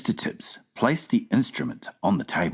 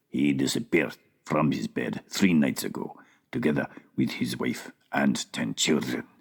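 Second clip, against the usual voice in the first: an unbothered ruler answering, pitched down; Sultan; 7 semitones lower than usual. A narrator's reading. Sultan on the phone.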